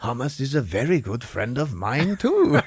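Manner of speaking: hammed-up delighted discovery; Lavash. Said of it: "He's like".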